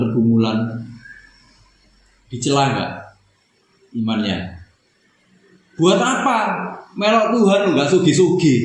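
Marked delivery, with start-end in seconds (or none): 5.7-8.6 high, scornful taunt; mocking jeer. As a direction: sneer at the believer. Voicing mockers of one's faith. (ejekan sinis bernada tinggi)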